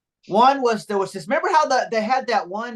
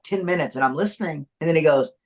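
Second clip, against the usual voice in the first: usual speaking voice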